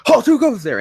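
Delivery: high, loud startled "who goes there" in a mock father voice; hammed-up startled dad. Fanfic dad caught sneaking round his own kitchen at night, calling out in mock alarm. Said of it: "and he's like".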